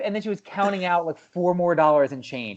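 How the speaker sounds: normal talking voice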